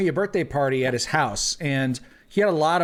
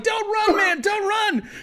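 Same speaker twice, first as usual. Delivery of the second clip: yelled plea. Retold or imitated. Friend tackling him in front of the house, shouting. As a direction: yell it while pinning him to the ground.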